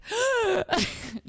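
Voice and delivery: big, loud, hammed-up gasp; dramatic gasp for air. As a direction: suck in air as if suffocating. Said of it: "dramatically gasping for air".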